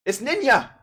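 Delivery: high, loud hammed-up thrill; mock fan excitement. Vlogger spotting Ninja in the video, mock thrilled. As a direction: squeal it like an overexcited fan.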